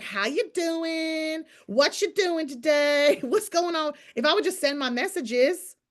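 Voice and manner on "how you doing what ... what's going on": high, slow mock-chummy chatter; sing-song mock small talk; chatty gossip voice. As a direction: imitate a gushing friend catching up. Mocking chatty calls to builders.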